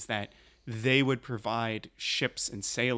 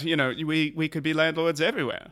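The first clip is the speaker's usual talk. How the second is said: put-on posh British accent; 6 dB louder than usual. Plays posh British landlord.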